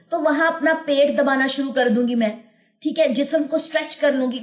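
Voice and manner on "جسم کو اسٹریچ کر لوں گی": ordinary talk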